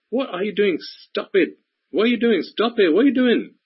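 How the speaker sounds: a shrill little conscience tutting over and over; nagging inner voice. Acted. Conscience stopping someone from sniffing perfume, high fussing scold. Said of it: "a voice in the back of your mind that goes".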